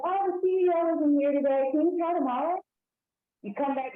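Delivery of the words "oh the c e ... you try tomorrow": higher, lightly voiced receptionist brushing you off; higher secretary voice. Host voicing the secretary turning the caller away, higher. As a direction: play a polite gatekeeper, pitched up. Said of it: "the secretary is like".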